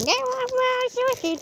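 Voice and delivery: high, pinched mock nasal singing voice; exaggerated nasal imitation of a singer; nasal mockery. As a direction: sing it through the nose to mock her. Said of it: "through her nose".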